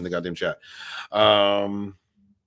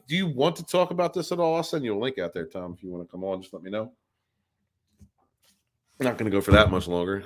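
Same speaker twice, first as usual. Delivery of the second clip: ordinary talk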